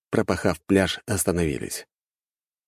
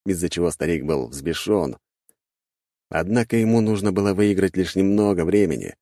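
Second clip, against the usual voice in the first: ordinary delivery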